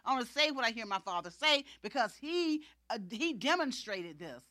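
loud, driving sermon delivery; forceful, insistent pulpit voice; full-force preaching. Real, not acted. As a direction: preach it with rising urgency.

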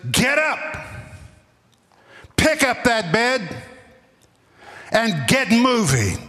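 high, slow, abrupt order. Acted. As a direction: bark it out, lifting the voice. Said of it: "lifts his voice and very abruptly".

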